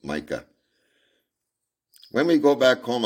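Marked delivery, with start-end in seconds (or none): 2.1-3.0 put-on little girl. Little girl voiced in story.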